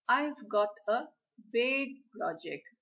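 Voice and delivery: "big" पर ज़ोर; stress on big. Shown as a demonstration. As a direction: hit the word "big" hard, then let it fall. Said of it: "So I am stressing on the word big".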